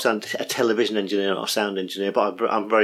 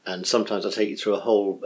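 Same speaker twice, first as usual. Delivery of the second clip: ordinary talk, nothing marked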